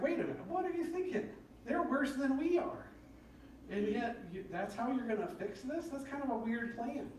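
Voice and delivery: high-pitched incredulous protest; acted-out indignant complaint; Habakkuk. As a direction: object in baffled disbelief, pitch way up. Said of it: "he complains to God".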